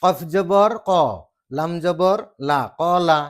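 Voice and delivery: loud, slow কফ জবৰ ক, লাম জবৰ লা, joined as কলা; Arabic spelling recitation. Shown as a demonstration. Teacher showing one part of an Arabic word, loud recitation. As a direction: chant out each letter with its vowel, then blend. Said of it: "এটা part হ'ল".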